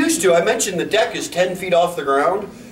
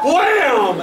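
higher, louder wham; loud bang noise; 7 semitones higher, 3 dB louder than usual. Retold or imitated. Telling hosts the dog slammed the floorboards, loud wham noise. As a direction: bang it out like a slam into wood.

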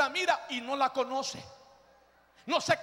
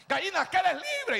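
high, insistent retold plea; urging on; 5 semitones higher than usual. Boy to a hen that will not run, urging it. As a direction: urge a stubborn hen.

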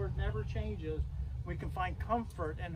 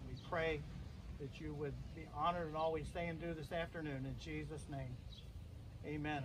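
hushed graveside prayer; 3 semitones lower than usual. Acted.

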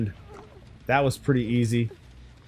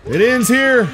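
menacing killer; 10 semitones higher, 7 dB louder, slower than usual. Acted. Killer streamer roleplaying menace.

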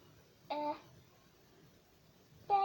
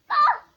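high, loud shriek; startled loud shriek; sharp scream; 13 semitones higher, 17 dB louder than usual. Acted. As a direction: let out a sudden cry. Girl cries out.

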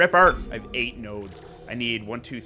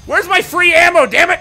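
being chased; 12 semitones higher, 8 dB louder than usual. Real, not acted. Frantic mid-fight.

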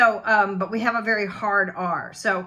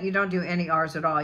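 ordinary delivery

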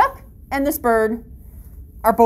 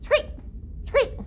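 bird screech; 11 semitones higher, 7 dB quieter than usual. Shown as a demonstration. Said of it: "The females will usually do a".

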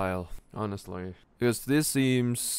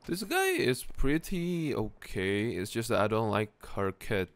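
ordinary talk

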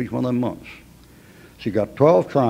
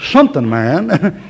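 laughing; 3 semitones higher, 5 dB louder, slower than usual. Real, not acted. Laughing at Jonah's cheek.

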